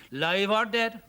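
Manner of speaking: loud Irish warning; grave Irish glass-maker. A narrator's reading. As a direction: cut in firmly with an Irish brogue.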